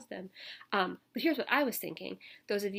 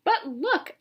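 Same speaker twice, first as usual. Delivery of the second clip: a raised, higher storybook yell; 9 semitones higher, 10 dB louder than usual. Acted. Boy in story yells to the sisters, raised voice. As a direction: call out to grab attention. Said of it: "I yelled".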